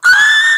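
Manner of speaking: loud, high thrilled gasp; gasp, totally overwhelmed with joy; excited gasp. Real, not acted. Reacting to koala plushie.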